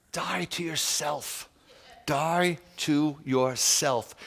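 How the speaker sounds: slow. Real, not acted. Preacher urges self-denial.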